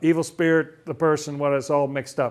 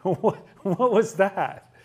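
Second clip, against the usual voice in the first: higher, drawn-out incredulous questions; amazed; 4 semitones higher, slower than usual. Acted. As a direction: act out stunned onlookers with a laugh breaking in. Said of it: "Then they were all amazed".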